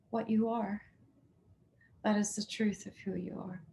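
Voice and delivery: soft, hushed meditation voice; hushed healing guidance to the heart; guided meditation. Acted. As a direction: gently guide them inward, softly.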